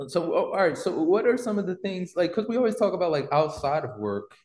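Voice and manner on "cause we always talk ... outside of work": ordinary talk, nothing marked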